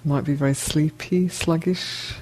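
ordinary talk, nothing marked

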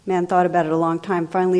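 ordinary delivery

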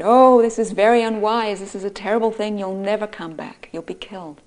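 a villager recoiling at a foolish plan; alarmed warning. Acted. Villager warns the boy against the monster, alarmed and loud.

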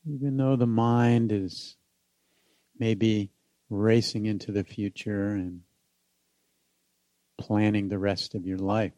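unhurried meditation guide with long pauses; guided meditation. Acted. Teacher inviting listeners back home in the body, guided meditation.

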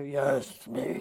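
low, slow, divine pronouncement; hammed-up deep voice of the Almighty; God voice. God answering the man on the cliff, deep slow voice. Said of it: "I have to put on my God voice".

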